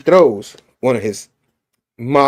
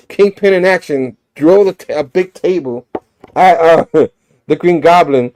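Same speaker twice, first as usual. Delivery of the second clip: ordinary delivery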